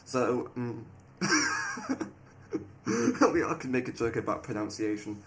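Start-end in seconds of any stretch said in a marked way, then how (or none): 1.2-2.1 squeaky high giggle; high-pitched laugh. Real, not acted. Player amused by the pronunciation bit, high squeal of laughter.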